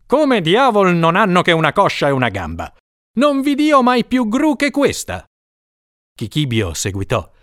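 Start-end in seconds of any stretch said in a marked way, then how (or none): 0.1-5.2 higher, indignant master; troubled lord. A narrator's reading. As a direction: scoff in disbelief at the cook. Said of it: "allora turbato disse".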